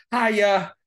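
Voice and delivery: put-on high, loud Boston-accented greeting; imitated Boston pastor. Pastor greets the newcomer in Boston, imitated Boston accent. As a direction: blurt a mock-Boston "Hiya". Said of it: "ternyata orang Boston kalau ngomong".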